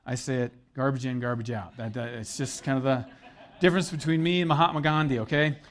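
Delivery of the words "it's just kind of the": normal talking voice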